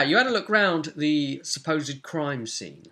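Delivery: ordinary delivery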